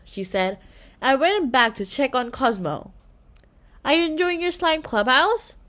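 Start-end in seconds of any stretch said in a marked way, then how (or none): none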